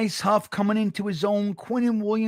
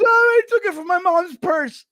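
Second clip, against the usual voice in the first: high, loud sheepish confession; caught kid confessing; 10 semitones higher, 5 dB louder than usual. Retold or imitated. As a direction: kid blurting the truth. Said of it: "And he goes".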